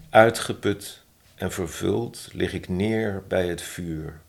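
measured poetry reading with pauses between phrases; poem recitation. Acted. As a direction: recite the love poem, lifting key phrases and letting line endings fall. Reads own poem aloud.

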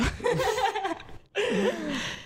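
a high giggle that breaks out; burst of high laughter. Real, not acted. Teased about her boyfriend, she laughs high.

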